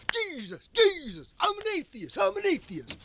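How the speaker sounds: high-pitched, parodied outcries; hammed-up emotional chanting; mock emotional grunts. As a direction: cry each one out high, mocking blind fervor. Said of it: "they're emotional grunts".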